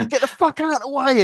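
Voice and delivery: high, loud gruff yell; shouting. Retold or imitated. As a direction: bark it like a crane boss losing it. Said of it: "Just shout".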